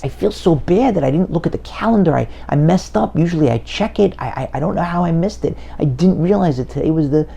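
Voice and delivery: aufgeregt und höher, stockend entschuldigend; hastig, nervös, mit Versprechern; flustered apology. Acted. Son answers father, distressed.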